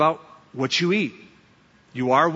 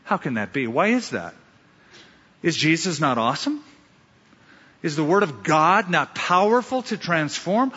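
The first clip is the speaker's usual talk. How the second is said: ordinary talk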